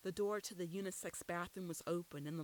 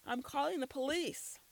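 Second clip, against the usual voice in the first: high, louder panicked cry; screamed; 8 semitones higher, 4 dB louder than usual. A narrator's reading. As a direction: shout it as she runs. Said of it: "and screamed".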